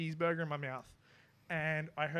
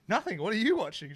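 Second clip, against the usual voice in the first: loud defensive denial; 6 semitones higher, 8 dB louder than usual. Retold or imitated. To his partner, caught watching something, loud defensive denial. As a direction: blurt it out high and loud, caught out.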